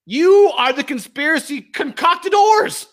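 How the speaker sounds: furious slow charge at CNN; slow angry accusation. Real, not acted. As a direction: hammer every word. Attacking CNN.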